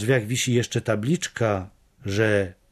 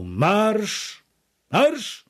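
high marching order, repeated higher; yelled command; 9 semitones higher than usual. A narrator's reading. Someone at the head of the column, yelled command. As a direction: call the march, pitching the repeat much higher. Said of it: "ktoś od czoła wrzasnął".

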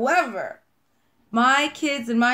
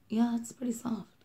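whisper; 7 dB quieter than usual. Real, not acted. Touching the soft wax.

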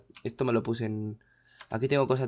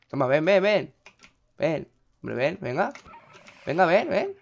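goading; 4 semitones higher, slower than usual. Real, not acted. Goads a game creature to come.

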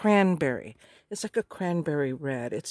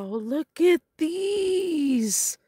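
much higher, slow, drawn-out gasp of wonder; awed delight; 9 semitones higher, much slower than usual. Real, not acted. Crafter showing new laces to viewers, in slow awed delight. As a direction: linger on each word in awe.

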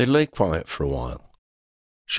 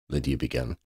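plain reading voice

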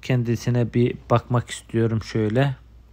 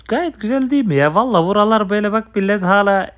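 excited; 7 semitones higher, 6 dB louder, faster than usual. Real, not acted. Excited about server map.

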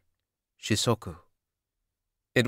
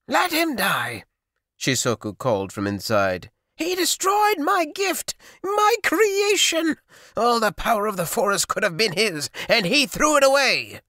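very high, loud, furious wailing; sorcerer's bitter rage; 13 semitones higher, 3 dB louder than usual. A narrator's reading. Sorcerer shouts from his hut about his ruined gift, high and furious. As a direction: spit out the grief and fury.

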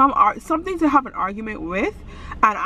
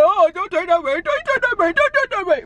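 nagging mimicry, higher on each repeat; mocking boyfriend; 9 semitones higher than usual. Girlfriend mocking her boyfriend's nagging, shrill mimicry. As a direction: parrot his backseat driving. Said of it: "you're like".

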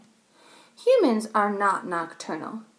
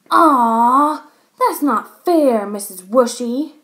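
put-on loud, drawn-out childish protest; loud complaint; 2 semitones higher, 9 dB louder, slower than usual. A narrator's reading. Boy complaining to teacher about losing his pick, loud drawn-out protest. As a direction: groan and complain like a thwarted boy. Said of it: "Max complained".

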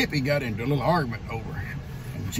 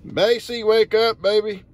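pet talk; 8 semitones higher, 6 dB louder, slower than usual. Real, not acted. Calling sleepy dog awake.

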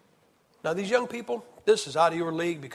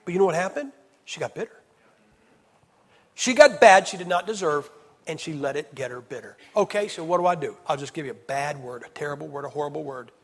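ordinary talk

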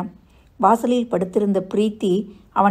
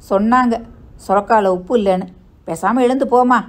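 raised, snapping dismissal; irritated retort; 3 semitones higher, 5 dB louder than usual. Acted. Son brushing off his mother, irritated. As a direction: snap back to shut her up.

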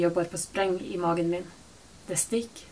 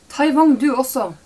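higher and louder, calling across the shop; shouting; 6 semitones higher, 11 dB louder than usual. A narrator's reading. Mother in the shop shouting at daughter to grab a cart. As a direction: shout the order across the aisle. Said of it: "roper hun".

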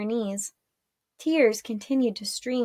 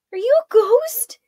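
high, loud incredulous question; acted alarm, pitched way up; stunned question; 13 semitones higher, 9 dB louder than usual. A narrator's reading. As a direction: ask it high and loud, rising in disbelief. Stunned, questions the revived boy.

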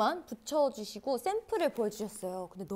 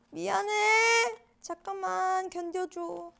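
soothing the card; 8 semitones higher, 4 dB louder, slower than usual. Real, not acted. Talking to a stiff card.

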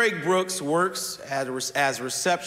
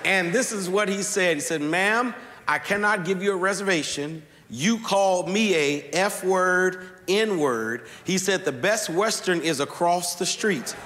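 higher, building preacher's storytelling; rising sermon climax; 4 semitones higher than usual. Acted. Preacher builds the story toward his message, rising preaching. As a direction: carry them toward the point, climbing. Preaching climbs in pitch and force.

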